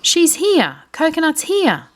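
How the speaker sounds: high, bright calling out; children thrilled the donkey is here; excited children. Acted. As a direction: call it out like thrilled kids. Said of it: "The children laugh. They're excited".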